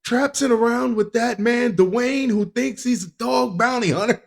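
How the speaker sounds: high-pitched put-on wife voice; nagging wife. Wife objecting to husband's outing with Dog, nagging high voice. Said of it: "you can hear his, uh, his wife right now".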